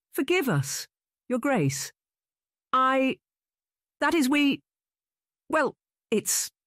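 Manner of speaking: high, halting, flustered apology, stumbling over each word; stammering. A narrator's reading. Young man stammers apology to the bishop, high and flustered. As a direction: caught out and stammering, high and broken. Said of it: "he stammered".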